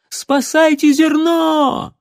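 high, slow, loud alarmed cry; shouting woman. Acted. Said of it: "закричала какая-то женщина". (женщина в панике кричит о пожаре)

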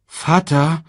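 high, loud cub voice; Simba. A narrator's reading. Cub trotting beside the king, high and asking. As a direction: call up to your father, high. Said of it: "sagte Simba".

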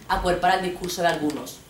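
ordinary talk, nothing marked

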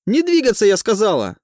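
high, loud screeching command; shrill shout. A narrator's reading. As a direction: screech it like an enraged official. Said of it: "визгливый голос".

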